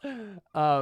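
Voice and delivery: high, strained scream of terror; frightened scream. Acted. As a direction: cry out as the figure looms over you. Paul looks up at looming Ernest.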